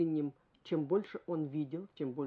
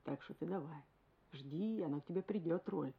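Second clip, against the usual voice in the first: father's gentle advice; 8 dB quieter than usual. Retold or imitated. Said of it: "Он мне говорил".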